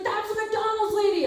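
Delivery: high-pitched excited call-out; excited recognition. Retold or imitated. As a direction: imitate someone thrilled to spot her. Retells man recognising her in store.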